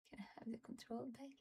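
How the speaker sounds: a fast pleading whisper at the screen; exasperated whisper. Real, not acted. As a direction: whisper it quickly under your breath. Whispering at the computer.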